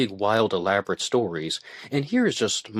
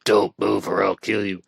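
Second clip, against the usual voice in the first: a louder threat forced through gritted teeth; mock intruder threat, teeth clenched and louder; 4 dB louder than usual. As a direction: spit the threat out through a locked jaw. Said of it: "talking through clenched teeth".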